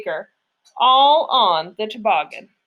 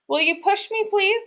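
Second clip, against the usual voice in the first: begging; 8 semitones higher than usual. A narrator's reading. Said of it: "Nikki begged".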